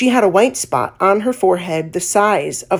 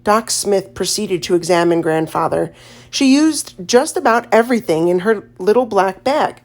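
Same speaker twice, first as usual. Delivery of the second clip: plain reading voice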